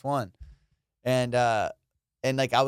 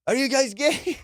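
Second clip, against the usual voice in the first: high, disbelieving question; mock-shocked accusing question; imitated incredulous dad; 10 semitones higher than usual. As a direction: ask like a dad who is taken aback.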